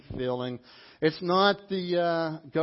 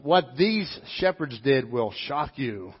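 ordinary delivery

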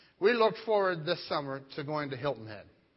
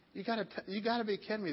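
quiet disbelief; 5 semitones higher, 6 dB quieter, faster than usual. Retold or imitated. Disbelief at the letdown.

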